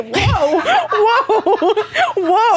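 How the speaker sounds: high laughing whoa. Real, not acted. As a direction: laugh through each whoa. Laughing at a crude joke.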